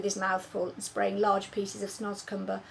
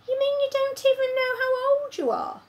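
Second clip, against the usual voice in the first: put-on much higher small-girl voice; a puzzled little girl; girl's voice; 6 dB louder than usual. Sophie puzzles over giants, higher girl's voice.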